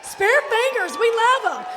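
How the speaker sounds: high, loud whooping hype; crowd hype shout. Real, not acted. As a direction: whoop it up for the audience. Greeting and hyping up the crowd.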